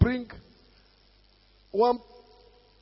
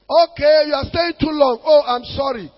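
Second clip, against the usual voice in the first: loud, higher-pitched acknowledgement; loud mock apology; 6 semitones higher, 8 dB louder than usual. Pastor answering members who complain, loud mock apology. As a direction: apologise loudly for show.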